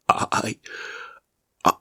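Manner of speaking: whispered, halting start, slow and broken; a frightened man who won't admit it; slow faltering stammer. Acted. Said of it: "he stammered".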